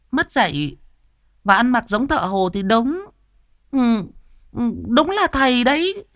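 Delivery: high, loud gossiping woman's voice; chatty village woman. Acted. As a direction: rattle on like a nosy neighbour. (giọng bà hàng xóm cao, nói liến thoắng)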